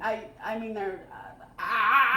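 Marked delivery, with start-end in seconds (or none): 1.6-2.2 wordless groan swelling into a cry; frustrated scream. Real, not acted. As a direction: let out exasperation as a long "ah". Incensed, runs out of words.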